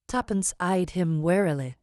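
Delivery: plain reading voice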